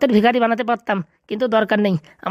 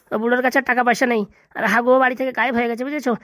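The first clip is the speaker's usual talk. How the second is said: ordinary talk, nothing marked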